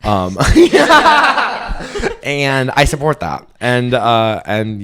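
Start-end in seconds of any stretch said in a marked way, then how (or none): 0.4-2.2 sudden high cackle; high burst of laughter. Real, not acted. Podcaster laughing at her own joke, high and loud.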